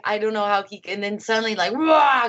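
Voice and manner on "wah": imitated sudden beast roar, higher pitch; explosive roar. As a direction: burst out with a mock roar. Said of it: "he would explode".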